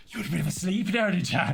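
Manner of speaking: rapid imitation of a pestering drunk; drunk Irishman. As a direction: pounce with chatty drunk nosiness, quickly. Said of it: "the Irish guy was completely drunk".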